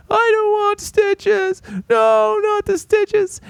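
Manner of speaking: very high squeaky mock wail; crying child imitation. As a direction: wail like a panicking little kid, hammed up. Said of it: "starts freaking out, crying".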